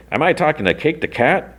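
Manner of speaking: a lightly put-on stranger on the phone; high caller voice. A stranger calls Cake, voiced high and mock-formal.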